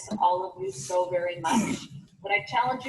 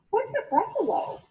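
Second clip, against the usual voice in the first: much higher puzzled question; high baffled query; puzzled snake; 12 semitones higher than usual. Acted. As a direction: snake asking in disbelief.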